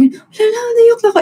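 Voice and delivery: high-pitched teary laugh-cry; crying while laughing. Retold or imitated. Retelling crying in front of Ate Gia, high laugh-cry. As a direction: squeak it out, half sobbing, half laughing. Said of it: "habang naiyak ako, tumatawa ako".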